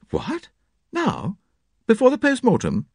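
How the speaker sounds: sharp high disbelief; surprised. Acted. John startled by Hastings' plan, high disbelief.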